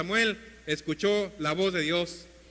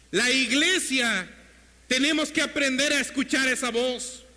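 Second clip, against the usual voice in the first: raised, higher sermon voice, driving on; fervent preaching; 5 semitones higher, 3 dB louder than usual. Real, not acted. Preacher urging the church to learn God's voice, fervent preaching. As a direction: preach it out with urgency and force.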